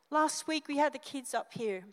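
normal talking voice

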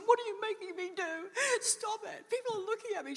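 high, crying plea to stop; tearful protest. Retold or imitated. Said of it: "And she burst out crying".

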